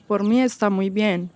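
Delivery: normal talking voice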